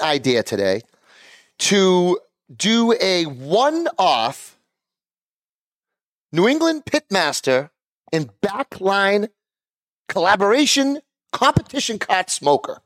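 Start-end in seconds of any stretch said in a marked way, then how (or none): 1.6-12.8 slow, drawn-out big reveal; announcer voice. Acted. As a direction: announce it like a game-show prize. Same names first announced grandly.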